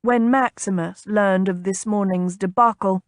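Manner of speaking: usual speaking voice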